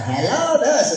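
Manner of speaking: kid's go at a cartoon voice; Wacko. Little boy showing he can do it, cartoon impression. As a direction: imitate a child doing a cartoon greeting. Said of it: "let me hear you do it".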